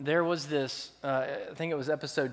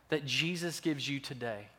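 ordinary delivery

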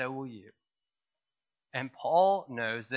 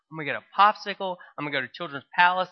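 put-on fast, eager childish glee; a kid who can't believe his luck, speeding up; excited kid; 4 semitones higher, 4 dB louder, much faster than usual. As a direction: rattle it off like a thrilled little boy. Child thrilled about toys.

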